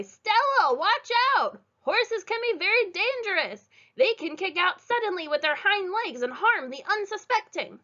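high worried brother's warning; urgent, fussy warning call; worried boy. A narrator's reading. Frank warns his sister about horses, high and anxious. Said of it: "shouted a boy".

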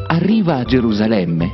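plain reading voice

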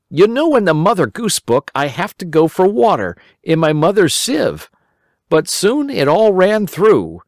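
put-on high, eager boy voice; a worried little boy pleading; Simple Simon. A narrator's reading. Simple Simon explaining to the rabbit, high boyish voice.

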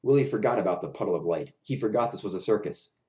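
usual speaking voice

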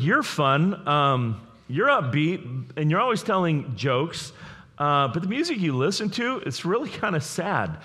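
put-on a playful, teasing take on the girlfriend's puzzled voice; mock girlfriend voice. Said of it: "she's like".

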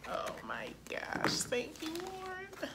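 breathless whispered thanks; whispered awe. Real, not acted. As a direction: whisper in delight over food. First bite of food.

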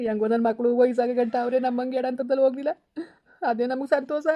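high-pitched, weepy gratitude; tearful relief. Acted. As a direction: cry with relief for the children. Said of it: "ಅವ್ವ ಅಳ್ಬೇಡ".

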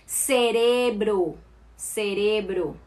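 cerebro with an S sound, said slowly; Latin American Spanish. Shown as a demonstration. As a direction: say cerebro with S, drawn out.

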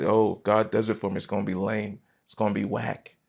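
ordinary delivery

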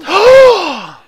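big loud intake of breath; sharp amazed gasp; shocked gasp. Real, not acted. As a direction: gasp hugely at a windfall. Sees five thousand in royalties.

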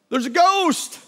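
high, loud cry of alarm; panicked cry. Pretend. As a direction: blurt it out like you've just seen a ghost. Disciples panic at seeing ghost.

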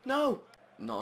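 high frustrated yell; pained high yell; anguished shout. Real, not acted. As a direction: cry out at the save. Keeper saves, frustration.

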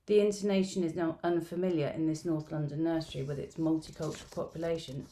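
ordinary delivery

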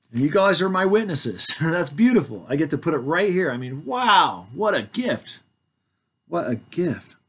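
delighted. Real, not acted. Joyful about correcting the record.

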